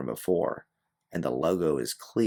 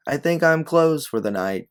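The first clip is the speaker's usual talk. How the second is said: called out over the shoulder, higher and louder; 11 semitones higher, 8 dB louder than usual. Acted. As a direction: toss it back over your shoulder while walking away. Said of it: "you say over your shoulder".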